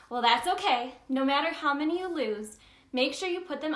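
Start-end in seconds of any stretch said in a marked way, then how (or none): none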